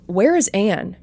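ordinary delivery, nothing marked

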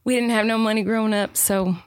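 put-on Southern accent monologue, rising emotion. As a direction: perform it as a Southern audition piece. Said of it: "doing this in a Southern accent".

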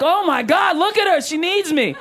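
high, hammed-up rescuer's panic; mock alarm. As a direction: gush in exaggerated concern for a damsel. Mocks men rushing to crying woman.

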